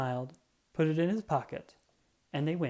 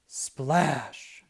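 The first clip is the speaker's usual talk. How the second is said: splash effect. A narrator's reading. Splash in puddle.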